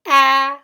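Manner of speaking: short vowel a as in apple. Shown as a demonstration. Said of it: "short vowel sound".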